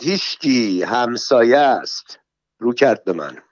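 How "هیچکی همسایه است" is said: plain reading voice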